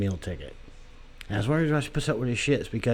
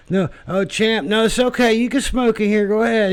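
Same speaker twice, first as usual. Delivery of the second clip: high, loud sing-song coaxing; coaxing wife voice; 11 semitones higher, 7 dB louder than usual. Retold or imitated. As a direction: talk to him like a confused old man, very high and bright-toned. Wife coaxing old boxer.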